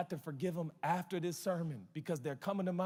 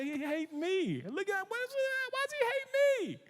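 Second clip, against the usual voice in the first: much higher, whiny mock hurt voice; mock whining. Pastor complaining to Pastor Stephen, high mock whine. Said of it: "I said".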